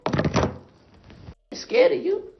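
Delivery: high whispered mock fear; mock scared whisper. Mocking the rival who posted his mama, high whispered fake fear. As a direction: whisper it like you're terrified, mocking.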